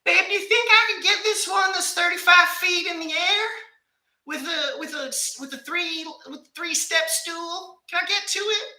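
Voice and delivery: wife asking her husband for help, in a high, pleading voice; imitating wife. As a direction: whine sweetly for help with the bulb. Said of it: "She's changing light bulbs outside".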